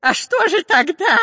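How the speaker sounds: very high, baffled question; Dyrka surprised. A narrator's reading. Spy hears there may be no gold, astonished. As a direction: squeak in amazement. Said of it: "удивился шпион Дырка".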